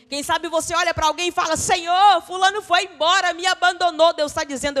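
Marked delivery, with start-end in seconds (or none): 1.6-4.1 loud plaintive cry to God; aggrieved complaint. Acted. Believer crying out loudly to God about being left.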